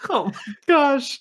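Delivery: high, slow, wheezing through laughter; helpless laughing exclamation. Real, not acted. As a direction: gasp it out mid-laugh. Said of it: "laughing at the comment".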